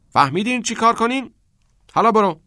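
high, loud teacher giving orders; loud instructions. A narrator's reading. Keating instructing the students lined up on the field, loudly. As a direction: call the orders out briskly to the class.

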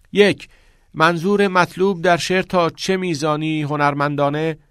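high, loud, careful schoolboy recitation; pupil reading aloud. A narrator's reading. Cameron reads the poetry textbook to the class, high recitation. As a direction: recite the textbook pitched well up, projecting.

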